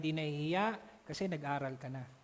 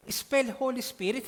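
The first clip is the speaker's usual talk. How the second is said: slow, loud and higher official command; consul's demand; 6 semitones higher, 6 dB louder than usual. Retold or imitated. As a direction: bark the order like a stern consul. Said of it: "sabi sa kanya".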